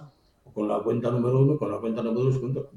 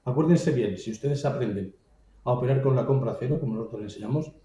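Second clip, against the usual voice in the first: ordinary delivery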